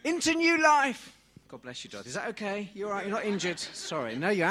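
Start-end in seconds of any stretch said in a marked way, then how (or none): 0.0-1.1 high, loud and slow; slow ringing cry of new life; triumphant proclamation. Pretend. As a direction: proclaim it like a resurrection. Climax of mock baptism.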